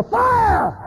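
high-pitched alarm shout; sudden piercing cry of alarm; screaming. Acted. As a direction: scream a warning to someone on a burning building. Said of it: "And I'm screaming".

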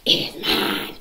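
loud, voiceless, forceful claim; fierce mock insistence. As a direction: act out a cranky woman forcing out each word. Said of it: "and she's like".

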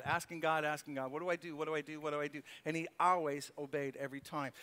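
normal talking voice

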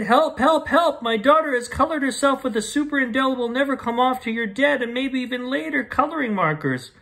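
high, panicky storybook mother; acted alarm, pitched high throughout; frantic plea. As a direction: call for help in a hammed-up panic. Mother's frantic call to the doctor.

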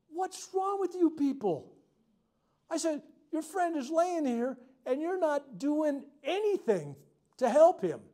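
put-on high, slow, angry yelling at the teens; angry shout. Preacher yells at the teens on the beach, high and slow. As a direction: rage at the kids who just stand there. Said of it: "and I'm mad".

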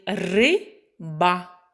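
Ри-ба in two separate syllables, RY then BA; split into syllables. Shown as a demonstration. Teacher shows the word has two syllables, splitting Ри-ба. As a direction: say Ри-ба syllable by syllable with a gap. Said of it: "Слово має два склади".

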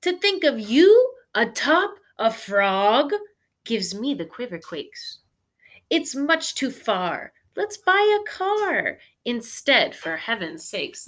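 fussy Miss Spider. Acted. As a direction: read her as a fussy, dramatic lady. Miss Spider voiced in story.

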